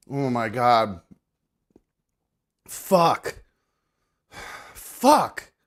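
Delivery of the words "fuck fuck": strained curse rising high; pained outburst. Real, not acted. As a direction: blurt it out in disgust. Struggling to eat.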